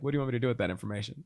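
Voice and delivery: rushed matter-of-fact question; fast deadpan. Retold or imitated. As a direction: rattle it off. Retold mom reply.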